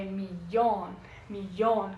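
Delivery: millón with the double L said like lh, mi-LYON; Spanish pronunciation. Shown as a demonstration. Teacher explaining the Spanish doble L, Spanish pronunciation. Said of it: "em espanhol".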